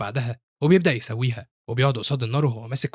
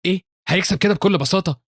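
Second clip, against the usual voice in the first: higher-pitched disbelief; shocked; 6 semitones higher, 4 dB louder than usual. A narrator's reading. Subordinate questioning his leader's sudden retreat, shocked. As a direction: blurt it out in shock. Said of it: "يبص له هان بصدمة".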